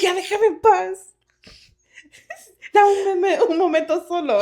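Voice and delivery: high, loud plea for peace; loud mock exasperation; exasperated dad. Retold dad demanding to be left alone.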